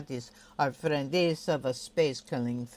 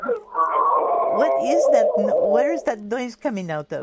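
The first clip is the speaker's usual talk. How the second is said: baffled outcry; 8 semitones higher, 9 dB louder than usual. Real, not acted. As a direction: drag it out in disbelief at the noise. Host baffled by the harmonica dog howl.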